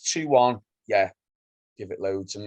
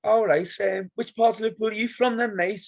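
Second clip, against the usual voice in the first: very high, mocking needle; high, goading mock-friendly question; passive-aggressive dickhead; 12 semitones higher than usual. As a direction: needle a rival fan in a put-on high voice. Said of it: "a bit of a dickhead like that".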